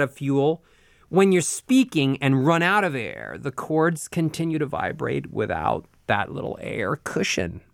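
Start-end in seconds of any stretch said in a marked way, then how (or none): 3.4-7.6 gravelly fade. Shown as a demonstration. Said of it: "they rub together aggressively".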